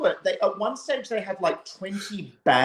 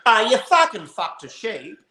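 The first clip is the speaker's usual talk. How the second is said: mock accusing shout; 7 semitones higher, 4 dB louder than usual. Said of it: "you said to a Kiwi".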